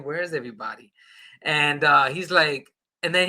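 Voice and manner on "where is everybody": quiet, wondering to himself; softly asked in his head; inner thought. Retold or imitated. Thinking it to himself alone, quietly puzzled. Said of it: "I'm thinking".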